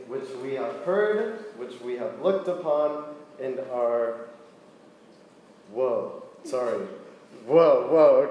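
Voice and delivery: soft, measured Bible reading, slowing at line ends; a calm, soft reading to the youth group; scripture reading. Acted. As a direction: read the passage with quiet reverence. Reads scripture aloud.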